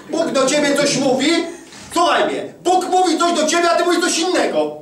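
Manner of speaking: high, loud exhortation; preacher scolding the congregation; loud rebuke. Acted. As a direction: shake the listener awake. Rebuking listener, preacher climax.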